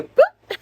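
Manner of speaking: very high, loud cartoon boop. Imitating the dog's ears perking up with a loud boop. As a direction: pop the sound like ears springing up.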